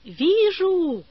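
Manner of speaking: high, drawn-out cry of discovery; narrator's lifted child's shout; excited cry. A narrator's reading. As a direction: call it out high and long. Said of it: "закричала Валя".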